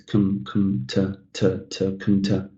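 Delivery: low, soft "com-" and "to"; reduced "com-, to" under the breath; slightly mumbled. Shown as a demonstration. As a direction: mumble the weak syllables "com-, to". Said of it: "like I'm mumbling a little bit".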